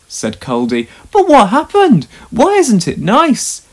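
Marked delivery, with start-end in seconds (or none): 1.1-3.6 anxious. Acted. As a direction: blurt it out, worried and stumbling. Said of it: "asked Duncan anxiously".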